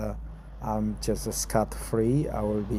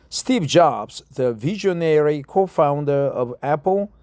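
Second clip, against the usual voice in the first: reading an article aloud; 2 semitones higher, 4 dB louder than usual. Acted. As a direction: read the article out to practise English.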